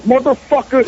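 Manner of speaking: sudden high angry outburst; flipping out. Retold or imitated. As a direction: snap at the guard, furious. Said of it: "I was flipping out at him".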